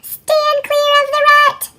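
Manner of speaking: high, loud, slow squirrel command; instructing squirrel. A narrator's reading. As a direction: call it out slowly like a bossy squirrel. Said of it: "instructed a squirrel".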